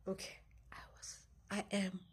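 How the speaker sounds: whisper. Real, not acted. Telling viewers she is still panicking, whispering. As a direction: breathe the words out, still rattled.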